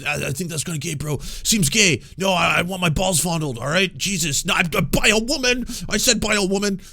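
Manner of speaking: loud, high mocking bro; mocking insecure bro voice. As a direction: ham up a defensive straight dude. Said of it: "would talk this way".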